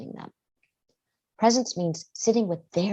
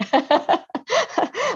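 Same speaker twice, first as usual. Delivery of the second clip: high laugh; 4 dB louder than usual. Real, not acted. Laughs at own example.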